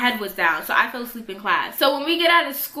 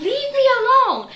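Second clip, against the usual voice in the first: high, loud, desperate "leave me alone"; shrill yell; 13 semitones higher, 6 dB louder than usual. Retold or imitated. Cornered girl screaming at the bully, high loud yell. Snaps at bully before swinging.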